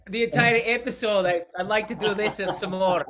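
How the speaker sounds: put-on loud, high-pitched Scottish accent. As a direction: keep up a hammed-up Scottish accent. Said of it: "your Scottish accent is pretty good".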